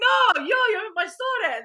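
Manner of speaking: high and laughing, words tumbling out; laughing reply. Retold or imitated. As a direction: giggle through the answer in Albanian. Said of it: "I'm like".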